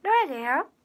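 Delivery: a hint of a bubbly TV star; Rola impression. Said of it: "本人かと思った？".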